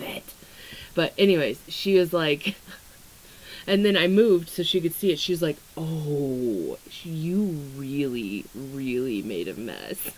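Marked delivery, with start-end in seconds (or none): 5.8-10.1 low, slow, disapproving drawl; judgmental friend voice. Retold or imitated. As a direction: say it like a judging friend.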